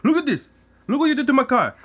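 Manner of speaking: high, loud mock outrage; mocking a driver's high-pitched yell; imitated angry yelling. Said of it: "yelling that I fucked up his B M W".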